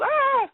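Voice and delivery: short, high-pitched mock scream. Retelling the girl's escape from the stage, mock scream. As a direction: imitate a girl's startled shriek. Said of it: "she's screaming".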